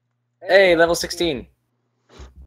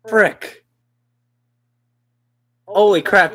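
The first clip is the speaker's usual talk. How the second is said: ordinary talk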